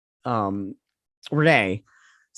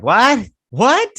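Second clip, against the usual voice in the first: much higher and louder, disbelief climbing; incredulous what; 10 semitones higher, 8 dB louder than usual. Real, not acted. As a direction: react like you can't believe the plot. Reacting to a silly plot turn.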